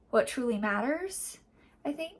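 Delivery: ordinary delivery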